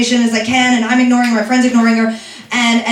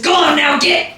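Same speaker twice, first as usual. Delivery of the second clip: higher cartoon rooster holler; Foghorn Leghorn; 5 semitones higher than usual. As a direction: shoo them off like a cartoon rooster. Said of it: "I was Froghorn Leghorn".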